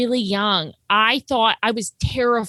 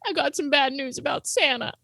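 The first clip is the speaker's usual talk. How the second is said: mock solemn kid; 5 semitones higher, 3 dB quieter than usual. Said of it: "my brother goes".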